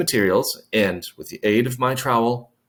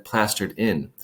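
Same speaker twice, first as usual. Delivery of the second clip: ordinary delivery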